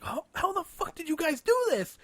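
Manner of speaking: very high, awestruck disbelief; squeaky-high retold astonishment; starstruck amazement. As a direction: imitate a thrilled fan who can't believe it. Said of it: "stars were in his fucking eyes".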